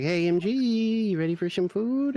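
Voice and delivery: high singsong call to a pet; excited pet-calling voice; calling the cat. Retold or imitated.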